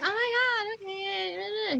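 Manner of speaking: high-pitched gushing squeal; mock giddy squeal; foolishly giddy younger self. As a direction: gush it high like a smitten fool. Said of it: "twenty-year-old me was a fool".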